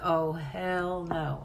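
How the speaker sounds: slow, dismissive "hell no"; mock-indignant refusal; fed-up refusal. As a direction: drag it out like you're flatly turning it down. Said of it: "I was like".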